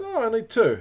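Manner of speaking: high, loud burst of surprise; surprised. Real, not acted. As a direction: jump up in pitch on discovering it. Reacts to unexpectedly low stat.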